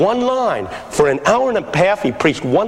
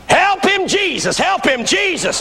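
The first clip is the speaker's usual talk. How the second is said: high-pitched pleading cry from the back; mock a woman calling out in despair; imitated congregant yell; 11 semitones higher, slower than usual. Lady in the back during a failing sermon, imitated yell. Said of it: "some lady in the back yelled".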